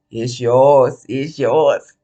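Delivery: very low, slow put-on rapper voice; deep, measured celebrity impression. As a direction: drop way down into an impression of a male rapper. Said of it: "In my [name] voice".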